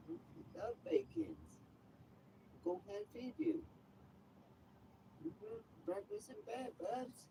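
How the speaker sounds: high cooing voice for the cat; pet talk. Acted. Baby-talking to his cat Romeo.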